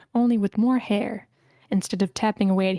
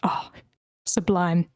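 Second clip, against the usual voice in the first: whisper; 2 semitones lower, slower than usual. Acted. Whispered after the song.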